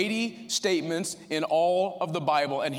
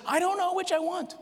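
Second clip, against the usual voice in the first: high, earnest voice of Paul; a preacher acting out the torn apostle; quoting Paul; 13 semitones higher than usual. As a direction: voice Paul's dilemma, pitched up. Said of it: "and he says".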